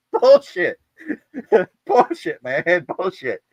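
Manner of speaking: high, loud scoffing, fading; laughing yells of bullshit. Retold or imitated. Shipmates scoffing at his claim, high and loud, fading out. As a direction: laugh and holler it down to a mutter. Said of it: "Everybody else is like".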